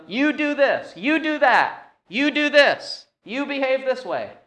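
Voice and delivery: high-pitched rattled-off list of orders; each order starts high and drops at the end; imitated commanding. Preacher imitates Paul telling believers what to do, commanding. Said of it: "before he tells them".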